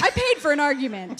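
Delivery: loud, laughing mock quarrel line; mock argument. To her husband in front of the church, a loud laughing mock quarrel. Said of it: "I kinda wanna mock something".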